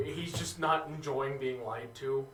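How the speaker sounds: ordinary talk, nothing marked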